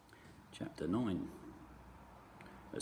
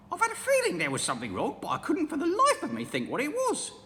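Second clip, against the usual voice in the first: put-on much higher, louder old woman's voice; a fretful old wife squawking high; Mrs Twit; 8 dB louder than usual. Mrs Twit's dialogue.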